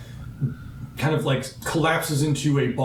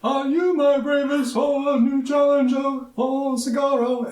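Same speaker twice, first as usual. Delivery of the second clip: high, slow, grand warrior voice; booming warrior challenge; 12 semitones higher, slower than usual. Acted. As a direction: proclaim it like a proud duelist. Warrior voice again.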